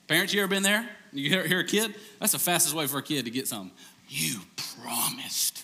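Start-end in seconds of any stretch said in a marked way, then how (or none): 4.1-5.6 slow, breathy whispered reproach; hushed kid's reminder. Retold or imitated. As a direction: whisper it like a child reminding dad. Parent imitating child.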